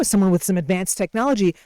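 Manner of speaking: normal talking voice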